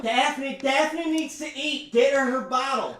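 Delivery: high, loud commanding mother; bossy mom voice. Acted. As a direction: order it like a frazzled mom. Mom orders bottle loudly.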